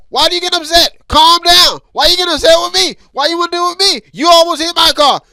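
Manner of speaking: loud, high exasperated pleading; upset driver. Pretend. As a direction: shout at the other driver. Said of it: "You be like".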